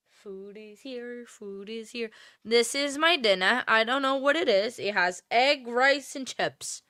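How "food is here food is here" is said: quiet singsong. Real, not acted. Repeats food announcement.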